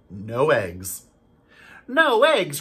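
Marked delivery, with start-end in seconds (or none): 1.9-2.6 much higher and louder despairing cry; dismayed cry. Acted. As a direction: cry out as if the farm is ruined. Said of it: "cried Farmer Brown".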